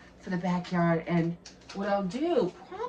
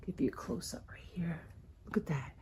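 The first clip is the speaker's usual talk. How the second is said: whisper; 13 dB quieter than usual. Real, not acted. Close-up of the giraffes.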